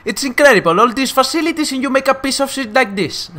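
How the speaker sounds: high, loud imitation of a film rant; drawn-out, accented movie outburst; Daniel Brühl as Niki Lauda. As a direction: complain loudly in a put-on, high film voice. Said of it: "¿No la habéis visto en versión original?".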